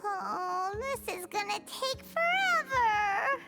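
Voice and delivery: slow, drawn-out whine; whining. Acted. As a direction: whine it out, dreading an endless task. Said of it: "Less whining".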